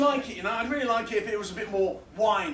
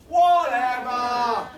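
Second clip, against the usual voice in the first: long drawn-out, falling "whatever"; big slow shrug of a word; dismissive refrain; 6 dB louder, much slower than usual. Acted. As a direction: stretch both halves slowly and let it drop. Repeated refrain of performance poem.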